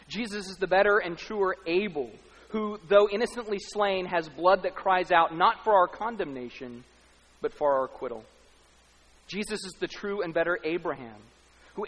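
loud, rising preacher's cry; preaching at full force. Acted. Preacher lifting the congregation in full force. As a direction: build the sermon to a ringing peak. Sermon climax on Abel.

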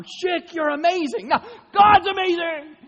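high, loud, rising excitement; gushing praise; excited praise. Real, not acted. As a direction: build up to God's amazing. Praising the razor, then God.

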